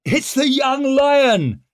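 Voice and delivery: high, loud, drawn-out holler; jovial full-voiced shout; reassuring call. A narrator's reading. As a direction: call out heartily to friends in the dark. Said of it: "called Leo, reassuring laughter in his voice".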